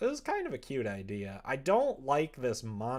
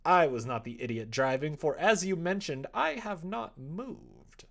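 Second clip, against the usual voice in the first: higher, louder sarcastic comeback, slowing at the end; mock defiant retort; 6 semitones higher, 7 dB louder than usual. Real, not acted. Gamer arguing back at the game's mom, sarcastic.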